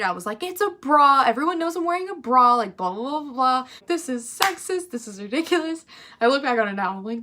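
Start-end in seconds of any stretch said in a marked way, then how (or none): 0.4-5.8 high, exasperated retold outburst; mock internal screaming, high-pitched; frustrated rant. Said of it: "so angry and, like, frustrated".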